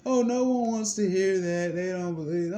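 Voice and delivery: high, loud put-on lawyer voice; mock his brush-off, pitched high; high mocking impression of the lawyer brushing him off.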